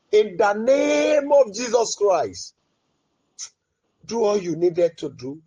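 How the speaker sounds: usual speaking voice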